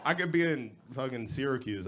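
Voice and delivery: normal talking voice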